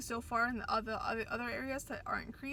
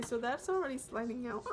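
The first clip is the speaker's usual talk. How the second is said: normal talking voice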